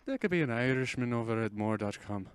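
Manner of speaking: hammed-up Irish woman voice; Irish accent. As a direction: lilt it like a little Irish woman. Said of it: "I sound like a little Irish woman".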